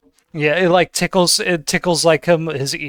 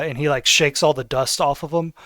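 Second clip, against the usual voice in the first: normal talking voice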